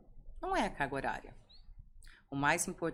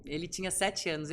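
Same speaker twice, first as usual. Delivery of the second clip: ordinary delivery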